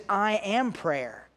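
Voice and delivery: "I am prayer" very slow, stress on "am"; slow, weighted "I am prayer"; emphatic, slow. Shown as a demonstration. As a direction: let "am" land, then let it sink in. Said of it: "It's very emphatic".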